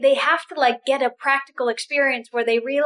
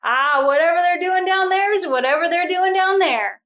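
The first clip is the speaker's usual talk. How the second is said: breezy indifference; 5 semitones higher than usual. Retold or imitated. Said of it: "sitting in heaven going".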